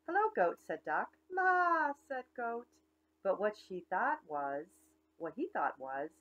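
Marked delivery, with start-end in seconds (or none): 0.0-0.6 high, bright little duck greeting; cheery duck. Acted. Duck greets the cow from his bike, high chirpy hello. 1.3-1.9 high, loud maa; mock goat bleat. Goat answers the duck, loud high bleat.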